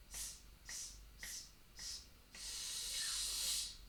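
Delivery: very soft hissing s pulses growing a little; s crescendo. Shown as a demonstration. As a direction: let the s hiss build from the abs. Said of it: "Let's do a, a s sound".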